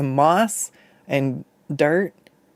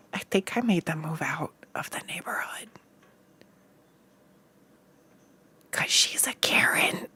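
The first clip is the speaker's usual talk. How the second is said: conspiratorial whisper; 11 dB quieter than usual. Real, not acted. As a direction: whisper it like a naughty secret. Confessing a gossipy secret to chat.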